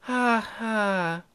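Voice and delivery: soft, stilted "ha ha"; mock laugh. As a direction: fake a laugh in two slow beats. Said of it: "when I could laugh".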